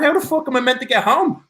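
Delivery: high, baffled squeak of a stranded man; mock bewildered. Stranded alone in the desert after the SEALs leave, high bewildered squeak. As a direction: play it lost and incredulous, voice jumping up.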